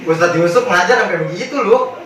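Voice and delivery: ordinary delivery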